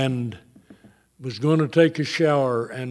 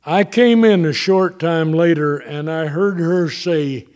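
ordinary delivery

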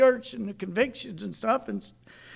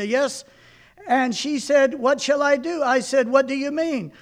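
put-on reading an old sermon aloud to a congregation; 4 semitones higher, 6 dB louder than usual. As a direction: read it as a preacher retelling his own story.

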